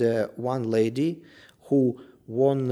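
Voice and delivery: ordinary delivery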